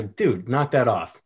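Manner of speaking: slow, neutral, emotionless order; flatly. Shown as a demonstration. Someone telling a dude to knock it off, flatly. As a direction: tell him to quit it with no feeling. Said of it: "if someone just says flatly".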